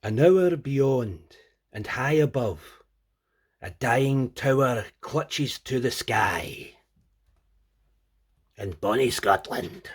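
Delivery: slow, loud, grand Scottish accent recital; mock Scottish accent, drawn out and booming. Shown as a demonstration. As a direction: declaim it in a hammed-up Scottish accent. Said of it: "Should I say it in Scottish?".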